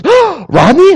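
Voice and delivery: high, pleased surprise; light mock surprise at meeting an old friend; old classmate surprised to see Rodney at the drink refill, greeting him high. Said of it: "She goes".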